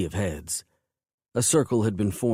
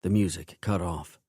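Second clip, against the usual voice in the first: plain reading voice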